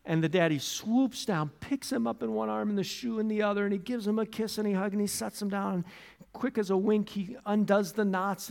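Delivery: ordinary talk, nothing marked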